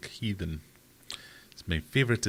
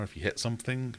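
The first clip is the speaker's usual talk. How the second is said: ordinary talk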